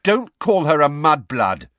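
loud, high angry retort. A narrator's reading. Ron and Ginny defending their friend, very angry. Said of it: "very angrily".

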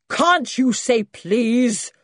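slow, loud, high roar; roaring old man. A narrator's reading. Great-granddad scolding the boy from the corner, roaring. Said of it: "roared a voice from the corner".

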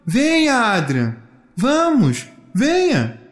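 high, lilting calls in short bursts; playful urging voice; beckoning call. Acted. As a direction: coax someone onward from afar. Sweet presence urges child on.

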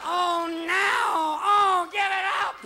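very high yowling, drawn-out cries; cat in heat. Acted. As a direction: wail it high and slow like a mating cat. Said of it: "Sitting under there going".

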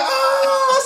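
very high, drawn-out "oh"; retold scream. Man watching a jail fight connect, high retold scream. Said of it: "So I scream".

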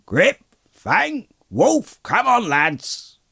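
high drawn-out shout to the dogs. A narrator's reading. Farmer setting his dogs on intruders, shouting. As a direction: call the dogs out in a high, loud voice. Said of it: "a loud voice was heard shouting".

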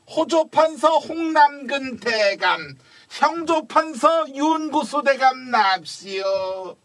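slow, drawn-out royal court herald call; court herald announcement. Acted. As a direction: announce the ministers' arrival to the hall. Court herald announces arriving ministers.

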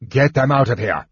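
mild acted shout; understated commanding shout; shouted order.

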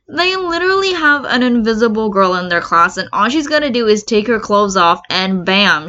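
plain reading voice

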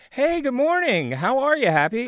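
very high, loud singsong hello; mock-cheerful greeting. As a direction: greet an angry coworker in a squeaky-high voice. Acting cheery greeting to angry coworker.

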